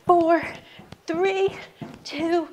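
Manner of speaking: high-pitched, driving count; rousing countdown. Real, not acted. Coach pushing class through last seconds, excited high countdown. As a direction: count them home with rising energy.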